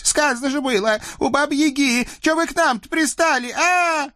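very high, panicky devil whine; near-hysterical devil; hysterical fright. Acted. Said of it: "от страха истерика началась". (истерика от страха)

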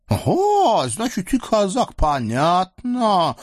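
high, slow, leering eastern sultan voice; lecherous sultan. Acted. Sultan leers at the captive women, high and gloating. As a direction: purr over the captives like a greedy sultan.

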